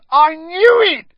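high, loud delighted yell; gleeful shout. Acted. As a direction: crow with glee. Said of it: "Stan shouted gleefully".

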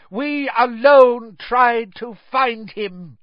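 high, loud, fanatical proclamation; defiant call. A narrator's reading. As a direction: call it out proudly to the judge. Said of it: "looked up at Crouch and called".

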